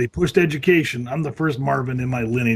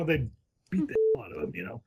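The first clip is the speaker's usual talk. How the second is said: ordinary talk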